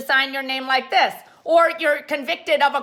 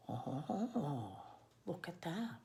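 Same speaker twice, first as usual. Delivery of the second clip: hushed awe; 9 semitones lower, 21 dB quieter, much slower than usual. Shown as a demonstration. Admiring a neat signature.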